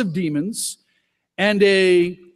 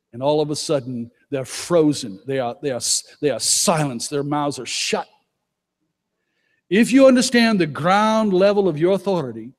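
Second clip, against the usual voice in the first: ordinary talk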